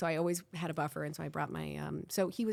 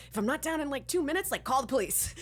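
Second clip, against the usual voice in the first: telepathic message; 7 semitones higher than usual. Retold or imitated. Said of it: "I'm, like, telepathically".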